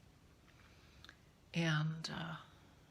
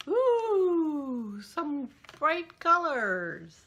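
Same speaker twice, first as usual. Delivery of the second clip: excited; 8 semitones higher, 10 dB louder, much slower than usual. Real, not acted. Opening advent yarn surprise.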